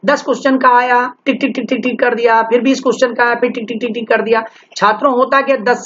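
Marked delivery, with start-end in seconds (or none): 1.3-2.4 quick-fire run of repeated ticks; rapid ticking. Retold or imitated. Mimics rapidly ticking off answers. 3.4-4.4 quick-fire run of repeated ticks; rapid ticking. Retold or imitated. Mimics rapidly ticking off answers again.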